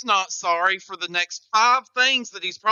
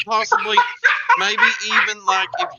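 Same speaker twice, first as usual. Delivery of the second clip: rising, louder and louder rambling hype; hammed-up build; 3 semitones higher, 8 dB louder than usual. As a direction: build to a shouted name. Announcer hyping contestant name.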